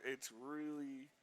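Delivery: ordinary delivery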